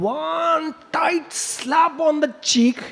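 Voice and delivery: high, very slow, near-shouted punchline; slow shouted storytelling climax; dramatic slap. A narrator's reading. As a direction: land the slap with a drawn-out cry.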